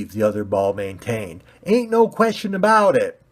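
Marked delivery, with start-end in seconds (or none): 1.6-3.2 high, loud bumpkin brag. Acted. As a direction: puff up and threaten. Pointy ball.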